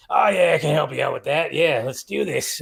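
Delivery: put-on easygoing Australian-accented assistant. AI helper offering help, imitated Australian accent. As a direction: put on an Australian accent, slowing toward the end. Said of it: "in an Australian voice".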